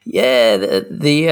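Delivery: hoarse from a cold; croaky voice. Real, not acted. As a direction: frog in the throat, rough and worn. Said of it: "the frog's in the throat tonight".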